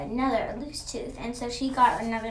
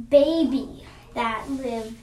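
ordinary delivery

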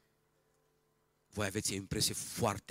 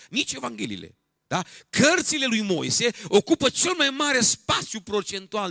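loud, high, emphatic sermon voice; full-force preaching; 13 semitones higher, 11 dB louder than usual. Acted. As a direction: drive the point home at pulpit volume.

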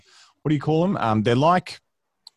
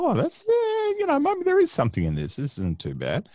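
mock surprised voice. Retelling own reaction to smell.

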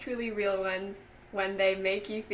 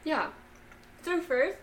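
eating chocolate; 7 semitones higher, slower than usual. Real, not acted. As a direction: mouth full of chocolate. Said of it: "there's, like, chocolate in my mouth".

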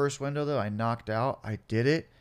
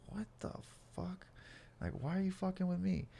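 very soft, high-pitched disbelief; hushed bafflement; 8 semitones higher, 10 dB quieter than usual. Acted. Man woken by police high beams, hushed bafflement. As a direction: mutter it incredulously to yourself.

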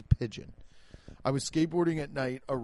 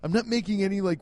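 ordinary delivery

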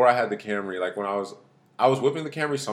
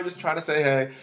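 ordinary delivery

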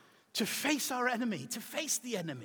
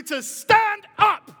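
slow, high, booming call; preaching climax shout; 10 semitones higher, 13 dB louder, much slower than usual. Acted. Preacher calling the church to stand, slow booming shout. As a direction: lift the whole room with it.

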